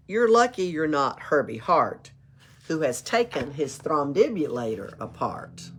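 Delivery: usual speaking voice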